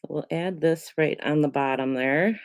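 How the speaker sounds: ordinary talk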